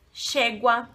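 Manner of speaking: "chegua" com Y chiado como "ch"; chiado argentino. Shown as a demonstration. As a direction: comece "chegua" chiando. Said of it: "eles falam muito chiado". ("chegua" chiado, à argentina)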